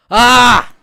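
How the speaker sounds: loud, high yell of frustration; one sudden outburst at full volume; frustrated yell. Real, not acted. Fan venting after the goal, frustrated yell.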